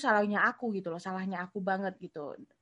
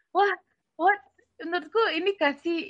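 surprised; 8 semitones higher, 6 dB louder than usual. Retold or imitated. Shocked by sister's kindness.

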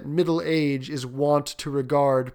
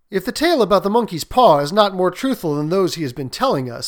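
lightly voiced sceptical son, raised; louder, higher doubting remark; Herbert; 5 semitones higher, 6 dB louder than usual. Son doubting the departed guest's tales, lightly sceptical.

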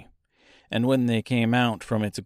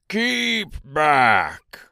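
rough voice calling out a warning, raised pitch; rough cry; 4 semitones higher, 3 dB louder than usual. A narrator's reading. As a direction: cry it out roughly to ward them off. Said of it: "rough voice cry out".

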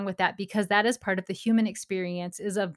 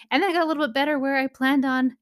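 usual speaking voice

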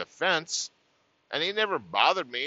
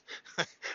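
soft breathy laugh; 10 dB quieter than usual. Real, not acted. Laughs at own story.

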